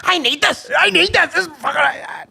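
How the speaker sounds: squeaky, pleading voice throughout; high whiny gimp. Acted. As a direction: play the wound-up gimp, shrill and desperate.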